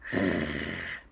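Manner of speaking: low, menacing imitated rumble; velociraptor growl. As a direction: growl like an offended raptor. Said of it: "who understood the word boring, went".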